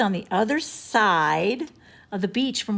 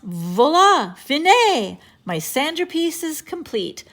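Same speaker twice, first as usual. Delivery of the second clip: higher, louder proud man; proud show-off; 7 semitones higher, 6 dB louder, slower than usual. A narrator's reading. Mr. Sunny showing off his sandcastle to everybody, grandly. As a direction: present it like a proud artist.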